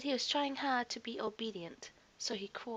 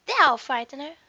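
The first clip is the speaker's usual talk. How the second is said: loud, higher boast; cocky cub; 5 semitones higher, 16 dB louder than usual. A narrator's reading. Faetua boasting before facing the elephant, loud and cocky. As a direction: sure he's the scariest.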